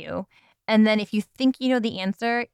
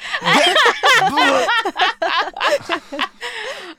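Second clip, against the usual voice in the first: loud laughter; 11 semitones higher, 5 dB louder than usual. Real, not acted. Laughing at the answer.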